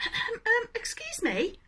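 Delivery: a hint of a small shy cricket; polite cricket. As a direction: cricket politely interrupting Gerald. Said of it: "coughed a cricket".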